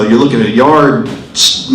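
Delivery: usual speaking voice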